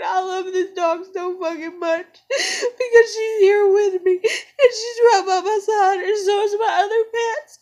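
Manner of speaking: tender words choked by crying; tearful half-laugh over her dog. Real, not acted. Crying while loving her dog.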